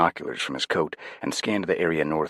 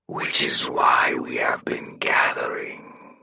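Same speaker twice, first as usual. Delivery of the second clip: slow, whispered, otherworldly chorus voice; a hollow many-voiced whisper, dragged out slow; 3 semitones lower, much slower than usual. Victor vowing revenge on the girl in a slow, eerie many-voiced whisper. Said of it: "his voice the sound of many".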